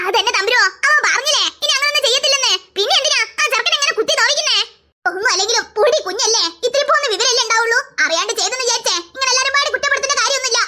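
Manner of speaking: put-on an indulgent grandma taking the boy's side; grandmother defending. Grandmother scolds sisters.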